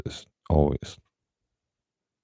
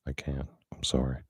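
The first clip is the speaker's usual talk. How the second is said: much softer, calm and sudden; calm, quiet refusal; 11 dB quieter than usual. Retold or imitated. Doctor quietly refusing the trucker, calm and sudden. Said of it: "very clearly, very sudden, calmly".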